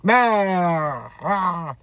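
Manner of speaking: put-on loud, high drunken "bah"; mock attack yell. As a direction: imitate a drunk's swinging roar. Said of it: "drunkenly swung at the guy".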